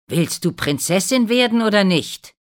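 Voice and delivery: impatient demand. A narrator's reading. Mother pushes her daughter to cut her heel, loud impatience. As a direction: press her loudly for an answer.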